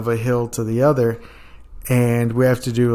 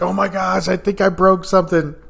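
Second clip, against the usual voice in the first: higher, mock whimper of pain; whiny complaint; 6 semitones higher than usual. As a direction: play the hurt recruit. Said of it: "and he's like".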